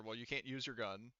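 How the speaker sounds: ordinary talk, nothing marked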